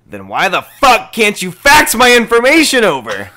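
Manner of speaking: high, very loud caller blowing up; sudden angry outburst. Retold or imitated. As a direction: explode at the customer service agent. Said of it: "goes from calm to complete douchebag".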